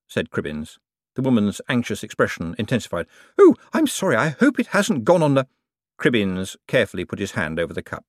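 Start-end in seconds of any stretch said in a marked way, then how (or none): 3.4-5.5 high, flustered apology; flustered lady. A narrator's reading. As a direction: rush the sorry up high in a panic.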